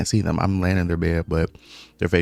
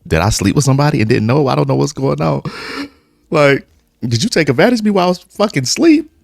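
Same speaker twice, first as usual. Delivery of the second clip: rising higher and louder in panic; panicked alarm; 8 semitones higher, 5 dB louder than usual. Retold or imitated. Waking next to a stranger, panic building higher and louder. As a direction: voice climbing in alarm. Panicked questions about the night.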